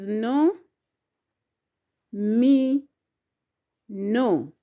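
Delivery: "no, mi, no" with a long pause after each syllable. Shown as a demonstration. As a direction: space out "no", "mi", "no" for learners. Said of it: "if you can pronounce the syllables".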